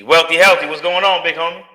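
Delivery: loud, high greeting to a viewer; hyped shout-out. Real, not acted. Streamer hypes up a viewer in chat, loud and high. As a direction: call out to your homie like he just walked in.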